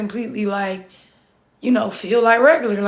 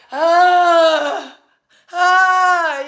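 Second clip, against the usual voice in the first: high open ah sounds, a little louder, let it pour out; reenacted release cry; 5 semitones higher, 4 dB louder than usual. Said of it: "Like, I was like".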